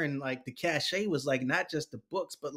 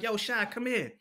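calling out; 9 semitones higher than usual. Retold or imitated. Buddy calling him over a second time. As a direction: call him again, higher. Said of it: "He like".

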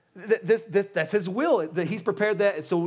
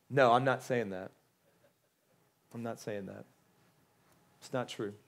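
hushed, low, measured correction; quiet denial; 6 semitones lower, 6 dB quieter than usual. Real, not acted. Preacher answers the skeptic's long wish list, quiet denial. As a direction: drop low and soft, with long pauses.

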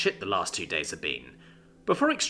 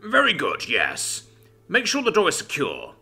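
ordering; 6 semitones higher than usual. A narrator's reading. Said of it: "Rachuk ordered".

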